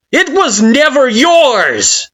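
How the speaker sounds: high, loud, slow cutting shout; scathing yell. Acted. Kara scorning your claim, scathing yell. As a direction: lash it out with contempt. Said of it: "yells Kara, scathing".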